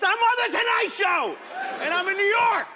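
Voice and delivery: very high, loud hysterical yell; upset shouting. Retold or imitated. Dad snapping at his kids in traffic, upset shouting. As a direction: scream it at the top of your range, acting frantic. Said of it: "I was so upset".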